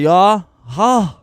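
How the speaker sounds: loud, high-pitched mock challenge; aggressive roadman. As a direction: imitate a little thug shouting in your face. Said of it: "the more aggressive one".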